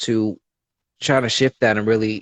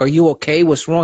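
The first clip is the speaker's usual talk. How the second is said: imitating mom, higher and louder, concerned; worried mom; 7 semitones higher, 6 dB louder than usual. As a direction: voice his worried mother. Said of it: "I called up my mom and she's like".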